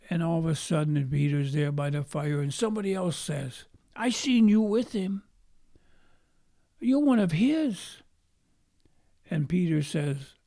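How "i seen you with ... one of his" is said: higher, insistent accusing voice; second accuser. Retold or imitated. Somebody by the fire accusing Peter, high and insistent. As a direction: point the finger, pitched up.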